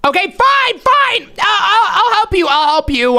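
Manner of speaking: high, flustered surrender; exasperated giving in. Acted. Host pressured into pranking friends back, exasperated. Gives in to viewer.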